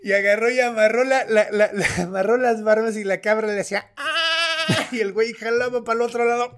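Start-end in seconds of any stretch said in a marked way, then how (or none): 4.0-4.9 high bleating goat cry. Retold or imitated. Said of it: "hacer el sonido de la cabra". (balido agudo de cabra)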